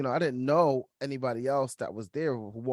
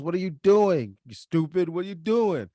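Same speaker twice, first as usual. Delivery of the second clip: mock coach yelling, higher and louder; imitating the coach shouting across the field; retold yell; 4 semitones higher, 3 dB louder than usual. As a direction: bark it at him like an angry coach. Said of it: "then he yells".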